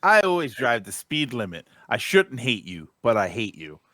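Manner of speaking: ordinary talk, nothing marked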